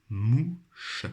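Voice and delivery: "mouche" drawn out as mou, pause, che; syllable by syllable. Shown as a demonstration. Teacher helping pupils find the syllable, syllable by syllable. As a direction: sound out "mouche" slowly in two beats. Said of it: "dans leur première syllabe".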